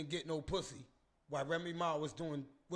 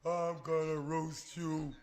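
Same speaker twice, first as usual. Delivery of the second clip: teasing threat; 7 dB louder, much slower than usual. Acted. Teasing friend on camera.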